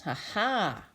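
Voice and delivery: ordinary delivery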